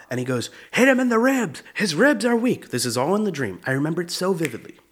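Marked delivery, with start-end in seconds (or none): 0.7-2.7 high, strained mock cornerman; hammed-up, high-pitched coach voice; boxing coach. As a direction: imitate a coach giving urgent advice mid-fight. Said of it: "my coach is in the corner".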